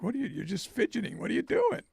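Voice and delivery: high mock Swedish accent, scolding the fidgeting. Buyer's voice scolding his chart fidgeting, high Swedish accent. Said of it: "it kinda reminds, like".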